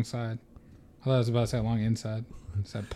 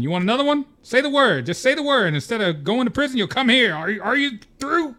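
high, loud furious teacher; yelling; 9 semitones higher, 3 dB louder than usual. Acted. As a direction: shout every new threat. Vernon escalating detentions, shouting.

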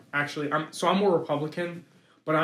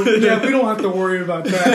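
laughing; 4 semitones higher, 9 dB louder than usual. Retold or imitated. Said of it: "literally I go".

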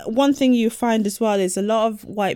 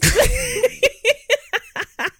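burst of laughter. Real, not acted.

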